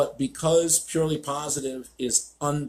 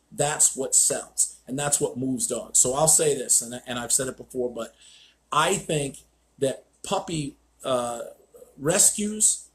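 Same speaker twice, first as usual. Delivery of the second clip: ordinary talk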